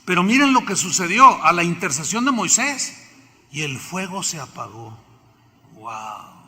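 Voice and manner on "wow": awe. Acted. Marvelling that the fire went out.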